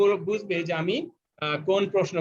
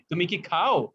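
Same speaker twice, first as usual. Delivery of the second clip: খাও said with weight; teacher correcting stress onto খাও. Shown as a demonstration. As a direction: press খাও, not কি. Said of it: "খাওয়ের উপরে stress করতে হবে".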